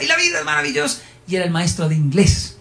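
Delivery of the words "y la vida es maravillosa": high, exultant mock yell; imitating shouting teacher. Teacher jumping on the desk, imitated high gleeful yell. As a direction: repeat the teacher's gleeful cry. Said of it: "y gritaba".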